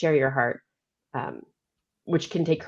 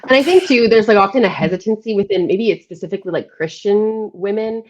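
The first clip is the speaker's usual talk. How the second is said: louder and higher, eager to jump in; excited; 4 semitones higher, 8 dB louder than usual. Real, not acted. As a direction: burst in with energy. Excited jump in after a laugh.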